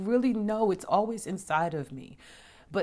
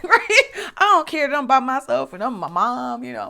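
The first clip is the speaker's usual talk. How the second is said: much higher and louder, laughing through the words; laughing loudly; 10 semitones higher, 13 dB louder than usual. Real, not acted. Telling viewers about riding rides alone, laughing out loud. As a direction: crack up at yourself.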